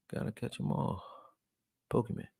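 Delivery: soft unvoiced "Gotta catch 'em all"; whispered slogan. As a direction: murmur the catchphrase as a playful aside.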